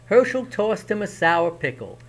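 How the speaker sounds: plain reading voice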